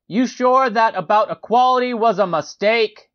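high, pointed acted questioning; questioning heckler. A narrator's reading. Man questions the narrator, acted.

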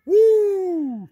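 loud, high "woo" held long; high drawn-out whoop. Real, not acted. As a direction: let out a piercing whoop on the ride. Scared rider on bouncing chairlift.

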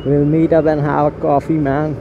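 usual speaking voice

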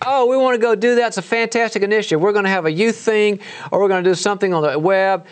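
high, gushing, overeager meeting voice; hammed-up enthusiasm for a new initiative; mock excitement. Mimicking colleagues in a meeting, gushing with mock enthusiasm. Mocking eager meeting talk.

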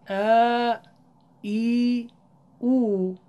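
ا ای او held long and clear; long vowels. Shown as a demonstration. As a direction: stretch each vowel. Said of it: "یا مدی و واو مدی،".

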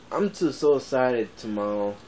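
usual speaking voice

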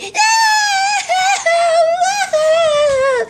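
long high-pitched fake wail; drawn-out hammed-up bawl; pretend screaming cry. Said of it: "Why am I hearing screaming and crying".

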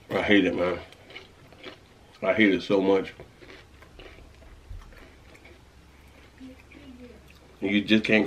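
chewing while talking; half-chewed food in mouth; mouth full. Real, not acted. Seen 0: Bites the food at -1.0, then talks holding the rest, jaw working.

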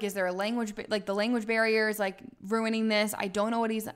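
normal talking voice

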